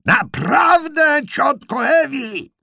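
very high, full-force shout, drawn out; exasperated bellow at the top of the voice; roaring. Acted. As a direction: roar it slowly for a deaf aunt. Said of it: "ryknął George Mira".

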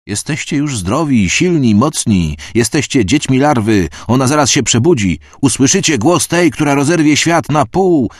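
high, loud croaking sermon; a high, loud rasping priest preaching to the crowd; croaking burnt priest. A narrator's reading. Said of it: "wyskrzeczał wypalony".

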